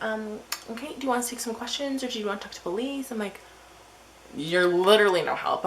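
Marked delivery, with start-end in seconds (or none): 0.0-3.1 higher, quick helpline voice; mimicked operator, pitched up; annoying hotline operator. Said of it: "they were really annoying". 4.4-5.7 loud retold outburst; fed-up, emphatic retort; exasperated.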